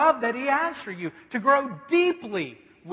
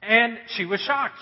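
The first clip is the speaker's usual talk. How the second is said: ordinary talk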